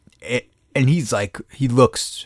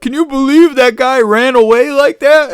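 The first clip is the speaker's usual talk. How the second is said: shocked women; 13 semitones higher, 5 dB louder than usual. Retold or imitated. Women voiced, outraged.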